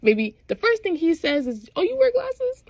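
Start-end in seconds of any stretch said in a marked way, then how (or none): 1.8-2.6 mock astonished squeal; very high incredulous question; retold surprise. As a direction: pitch it way up, amused disbelief.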